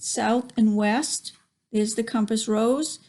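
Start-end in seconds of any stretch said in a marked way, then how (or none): none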